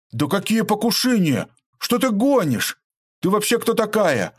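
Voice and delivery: высоко и громко, в растерянном возмущении; не верит ушам и кричит; ошарашенно. A narrator's reading. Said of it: "хлопал глазами Михаил". (растерянно возмущается, выше и громче)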